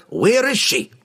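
high, forceful gruff question; demanding dwarf. A narrator's reading. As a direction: demand an answer now. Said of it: "Bruner demanded".

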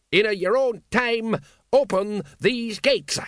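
high, slow drill-sergeant bark; barked command. Acted. Vimes barks an order at Carrot, high and slow. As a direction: bawl the order at the recruit. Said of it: "Vimes barking an order".

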